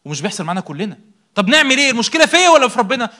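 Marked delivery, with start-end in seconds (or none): 1.3-3.1 higher, louder exasperated asking; voicing the listener's doubt, louder; exasperated question. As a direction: demand an answer, exasperated.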